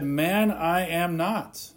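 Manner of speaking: ordinary delivery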